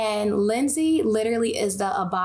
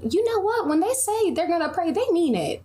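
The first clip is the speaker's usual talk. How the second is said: amazed realization; 9 semitones higher than usual. Retold or imitated. Voicing amazed listener.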